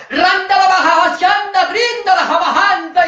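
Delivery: a mocking imitation of fake speaking in tongues. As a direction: ham up fake tongues like a babbling zealot.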